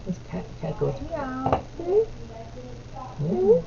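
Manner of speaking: soft, hushed bedtime-story voice; quiet picture-book reading to a little one. Real, not acted. Reads picture book to toddler.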